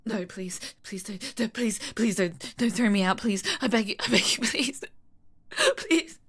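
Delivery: low frightened begging. Acted. Begging not to be thrown out.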